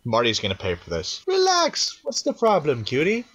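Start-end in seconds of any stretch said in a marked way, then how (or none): 1.3-3.2 high, teasing character voice; flirty character. Acted. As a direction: tease them, pitched up. Character line, first take.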